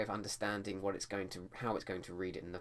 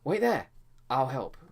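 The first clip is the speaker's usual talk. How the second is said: much higher, loud urgent shout; 6 semitones higher, 10 dB louder than usual. Shown as a demonstration. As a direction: shout it to a friend in danger. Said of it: "shout it out".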